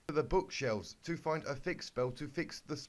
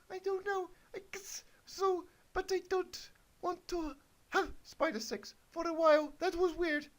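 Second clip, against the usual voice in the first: put-on much higher, squeaky girlish falsetto; squeaky Twilight voice. Twilight answers Big Mac in a high squeaky falsetto. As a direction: pitch way up into a silly cartoon squeak.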